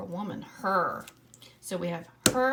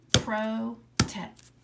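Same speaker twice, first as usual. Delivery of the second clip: "Protect" louder, split into pro and tect; clapping syllables; 3 semitones higher, 7 dB louder than usual. Shown as a demonstration. Teacher clapping out protect with the class. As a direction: clap out pro, tect, loud. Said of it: "Try it again".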